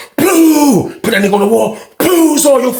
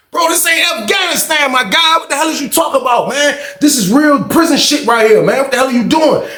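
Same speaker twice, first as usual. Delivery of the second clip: retelling an exasperated yell at a friend; 8 semitones higher than usual. Retold or imitated. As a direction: shout at him that he's lost it. Narrator yelling at friend, exasperated.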